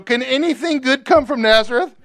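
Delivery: high, incredulous scoffing question; scoffing Nathanael. Retold or imitated. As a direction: laugh off Nazareth as a joke. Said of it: "explained [name]".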